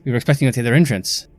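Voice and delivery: high, loud and hurried, feigning surprise; loud cover announcement. Acted. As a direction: call out the greeting loudly to cover. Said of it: "just for the sake of cover, announcing".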